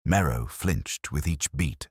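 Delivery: ordinary delivery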